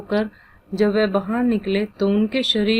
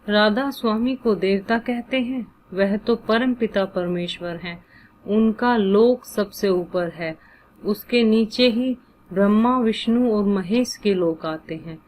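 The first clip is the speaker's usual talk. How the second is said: plain reading voice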